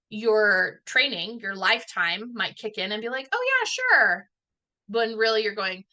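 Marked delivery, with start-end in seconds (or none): 3.3-4.3 high-pitched people-pleasing yes; over-keen mock agreement, pitched way up; eager agreement. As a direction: chirp an automatic yes, acted out. Automatic people-pleasing yes, acted out.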